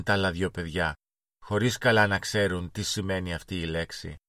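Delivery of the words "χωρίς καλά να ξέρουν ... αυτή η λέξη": usual speaking voice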